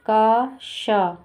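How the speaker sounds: का शा said very slowly in two parts; syllable by syllable. Shown as a demonstration. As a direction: break cash into का and शा, slowly. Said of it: "touch नहीं करना है".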